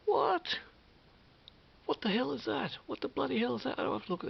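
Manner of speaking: high, loud shocked partner; partner's shock. Retold or imitated. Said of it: "my partner's like".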